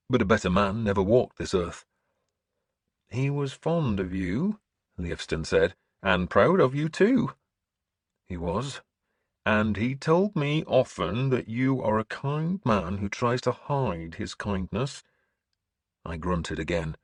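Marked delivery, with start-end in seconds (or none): 3.1-4.6 higher, slow, gentle churchman; lifted, kindly priestly voice; kindly bishop. Acted. Bishop speaks kindly of tutor. 6.0-15.0 higher, slow, gentle churchman; lifted, kindly priestly voice; kindly bishop. Acted. Bishop speaks kindly of tutor.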